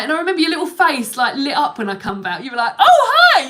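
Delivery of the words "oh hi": high, loud mock-delighted burst; delighted greeting. Imitating her partner pleased to see her again, delighted shout. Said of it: "You were really pleased to see me again".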